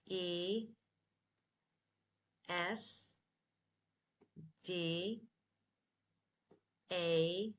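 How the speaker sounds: letters one at a time with long pauses; spelled slowly. Shown as a demonstration. Teacher helps learners through a long word, spelling slowly. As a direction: spell slowly, leaving room to repeat each letter. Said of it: "Spell it slowly with me".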